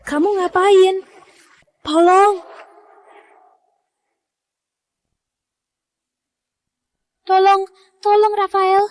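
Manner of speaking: high, frantic calls for help; desperate, calling out for anyone; panicked cry for help. Acted. Sister falls, calls for help.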